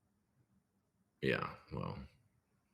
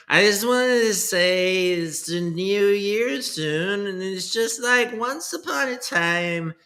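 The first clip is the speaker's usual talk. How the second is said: high put-on coin dev voice; 8 semitones higher, slower than usual. Streamer parodying a crypto dev's new year message, high and slow. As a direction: ham up the goofy developer persona, stretched out. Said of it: "that's not even how I talk it".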